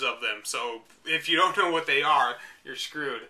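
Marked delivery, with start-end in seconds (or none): none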